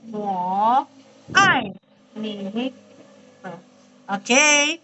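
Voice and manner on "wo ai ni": "Wo" low and dipping, "ai" falling, "ni" low; exaggerated tones. Shown as a demonstration. Teacher reading pinyin for the class, exaggerated tones. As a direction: separate "Wo", "ai", "ni", each tone drawn clearly. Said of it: "Oh, ada nadanya".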